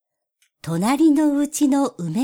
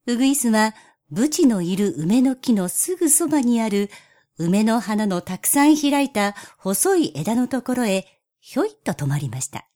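ordinary delivery, nothing marked

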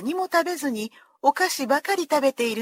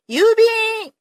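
high, loud shout of a postman at the door; loud call; 9 semitones higher, 7 dB louder than usual. Acted. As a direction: call out loudly like the mailman. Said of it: "と大きな声がして".

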